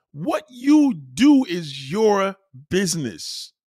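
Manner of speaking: very slow, each word stretched; drawn-out emphatic lecture. Retold or imitated. Repeating it to an anti-vaxxer, slow and emphatic. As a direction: spell it out slowly and firmly.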